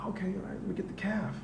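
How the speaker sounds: high, soft, sing-song humoring; sweetly patronizing okay; mock indulgent. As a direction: humor it like a fussy patient. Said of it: "I'm like".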